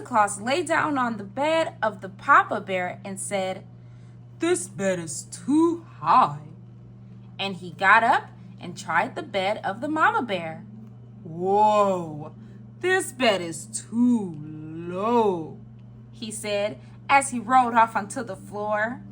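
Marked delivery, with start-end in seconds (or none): 4.4-6.5 slow storybook Santa voice; Santa Claus. A narrator's reading. 11.2-15.6 very slow storybook Santa; Santa Claus. A narrator's reading.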